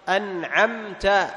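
"أنعمت" very slow with the nun and the mim each clearly sounded. Shown as a demonstration. Teacher modelling clear nun and mim for the class, slow. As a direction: draw out "أنعمت" and sound the nun before the ain. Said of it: "أيضًا تظهر سكون الميم".

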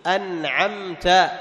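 "أنعمت" very slow with the nun and the mim each clearly sounded. Shown as a demonstration. Teacher modelling clear nun and mim for the class, slow. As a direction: draw out "أنعمت" and sound the nun before the ain. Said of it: "فالإظهار الأول إظهار إيش".

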